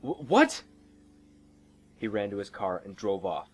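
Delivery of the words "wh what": high, loud, stammered question; stunned disbelief. Acted. Driver is told to leave, stunned. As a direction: stammer it in shock, rising.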